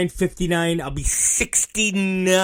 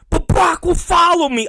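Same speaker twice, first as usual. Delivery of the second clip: put-on whiny kid; 12 semitones higher than usual. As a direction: plead like a scared kid. Son whining back at dad.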